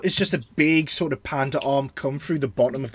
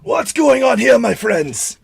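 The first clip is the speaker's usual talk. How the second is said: high, loud, hearty call from afar; big booming character shout; 8 semitones higher, 4 dB louder, slower than usual. Acted. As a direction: boom it out to friends in the distance. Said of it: "you hear a booming voice in the distance".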